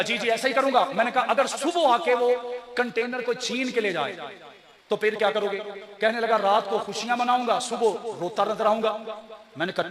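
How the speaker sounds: usual speaking voice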